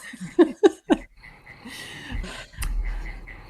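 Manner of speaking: breathy laughing fit; contagious laughter. Real, not acted. Host still laughing with guest. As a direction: laugh along, can't stop. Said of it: "quite contagious".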